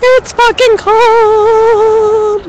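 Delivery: slow, stretched-out grumble; drawn-out complaint. Real, not acted. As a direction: drag the words out slowly. Complaining about the cold.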